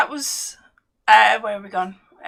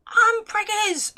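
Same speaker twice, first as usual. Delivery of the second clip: high, slow sing-song announcement; announcing pregnancy; 11 semitones higher, much slower than usual. Retold or imitated. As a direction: draw it out, high and gleeful. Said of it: "when someone goes".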